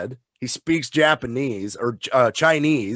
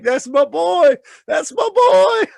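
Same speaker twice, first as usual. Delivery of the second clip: high cackling proud-dad shout, building; gleeful Chucky; 5 dB louder, slower than usual. Retold or imitated. Chucky cut up by his son with the axe, laughing and gleeful. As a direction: crow it with sadistic delight. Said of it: "and he's laughing".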